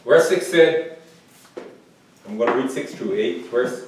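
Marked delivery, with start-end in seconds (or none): none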